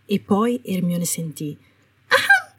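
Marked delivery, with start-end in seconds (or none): 2.1-2.5 sudden very high loud yelp; a shrill-free jolt of surprise, high and loud; startled squeak. Acted. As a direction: Umbridge caught out, high yelp. Umbridge startled by accusation.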